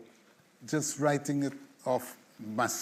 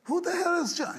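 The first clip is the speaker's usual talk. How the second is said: much higher, baffled self-question; puzzled outburst; 12 semitones higher than usual. Retold or imitated. Songwriter puzzled by a name in his song, baffled. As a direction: ask yourself in sudden bewilderment. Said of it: "I'm saying".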